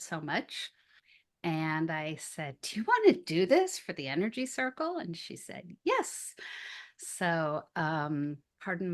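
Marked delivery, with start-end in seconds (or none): 2.6-5.0 higher, louder, quick retold ask; pitched-up, rushed question to a friend; eager invitation. Retells her eager invitation. 5.8-6.3 very high-pitched retold yes; squeaky-high, excited agreement; eager yes. Retells friend's eager acceptance.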